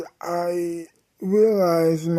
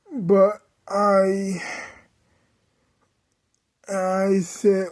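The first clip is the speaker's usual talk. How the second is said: ordinary talk, nothing marked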